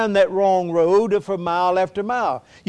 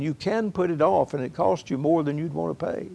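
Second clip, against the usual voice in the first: ordinary talk, nothing marked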